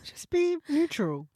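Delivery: slow it down, teasing her to settle; slow and soothing. Real, not acted. Teasing friend about balance.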